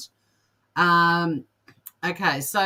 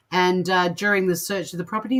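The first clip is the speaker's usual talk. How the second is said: normal talking voice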